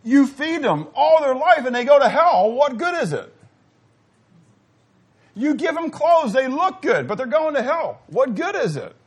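high, loud pleading sermon cry; preaching at full force. Acted. Preacher to church, grieving children lost: loud sermon cry. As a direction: thunder it out to the congregation.

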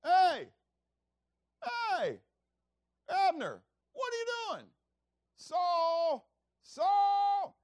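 high calling shout across the hills; a far-off shout to rouse the camp; crying out. Retold or imitated. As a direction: yell out across the valley to wake them. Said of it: "he cries out".

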